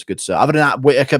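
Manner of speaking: ordinary talk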